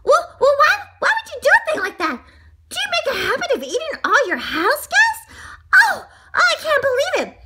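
high, loud indignant little voice; outraged donut character. A narrator's reading. Donut confronts his would-be eater, shrieking outrage. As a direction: scold him in a high, loud squeal.

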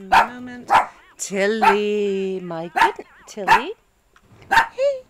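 loud over the barking dog; shouting. Real, not acted. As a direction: shout to be heard over the noise.

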